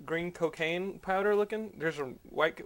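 ordinary talk

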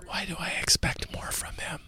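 hushed whispering host voice. Acted. Host whispering to his co-host on the Whisper Show. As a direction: keep the whole show in a whisper.

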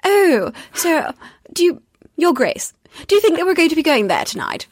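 high, mock period-drama English accent; Bridgerton English accent. Viewer imitating Bridgerton to Your Grace, mock aristocratic English accent. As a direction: play a Regency lady in an exaggerated English accent. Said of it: "everyone's watching Bridgerton on Netflix".

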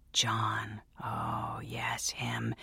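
hushed whisper to herself; a secret whisper. Acted. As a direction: breathe the name like a secret. Narrator whispers remembered name.

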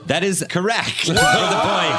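loud, high, laughing call; laughing announcement. Real, not acted. Host awarding a point while laughing loudly.

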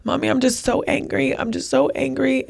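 a hint of an angry young son's plea; retold upset child. Young son to his mother after running off, lightly imitated angry child. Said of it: "he looked at me and he said".

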